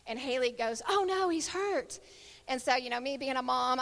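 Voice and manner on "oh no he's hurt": higher, drawn-out cry of concern; retold alarm. Haley spotting the boy's fall, alarmed. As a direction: imitate a worried onlooker, pitched up.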